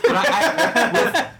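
high, loud, helpless laughter in the words; laughing. Real, not acted. Guest tries to continue about the movie, laughing. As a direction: struggle to resume through laughter.

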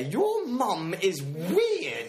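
slow, drawn-out teasing jab. Pretend. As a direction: stretch it out to tease him. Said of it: "In that case, I can say".

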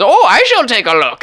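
put-on high, loud minimum-effort accent; Christopher Columbus. Captain goes out to check the sighting, high put-on accent. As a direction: announce it as a hammed-up captain. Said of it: "minimum effort is what this accent".

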